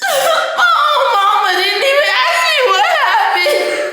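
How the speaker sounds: high, loud, laughing outcry; laughing shout. Real, not acted. Laughing loudly that her friend never asked what happened. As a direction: shriek it in laughing disbelief.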